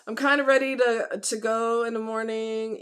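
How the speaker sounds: normal talking voice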